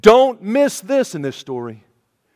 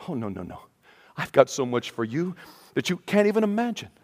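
hushed Jesus; 5 semitones lower, 10 dB quieter than usual. Retold or imitated. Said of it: "And Jesus says".

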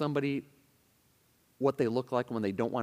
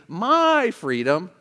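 "my" drawn out high and loud; stress on MY; 4 semitones higher, 6 dB louder, much slower than usual. Shown as a demonstration. Preacher answering where the emphasis is, "my" heavily stretched. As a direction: lean long and hard on "my". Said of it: "where's the emphasis in this attitude?".

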